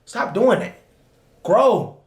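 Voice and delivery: ordinary talk, nothing marked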